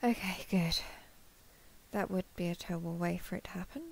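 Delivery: fast, soft relieved aside; relieved whisper. Real, not acted. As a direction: hurry it under your breath. Relieved, muttering to self.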